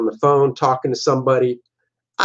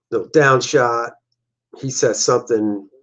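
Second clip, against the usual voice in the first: normal talking voice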